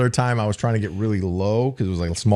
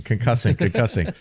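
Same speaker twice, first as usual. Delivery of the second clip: usual speaking voice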